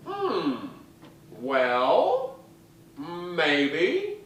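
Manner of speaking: a hint of the booming diva, drawn-out hmm. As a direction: tease the kid with a slow maybe. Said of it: "in that booming voice".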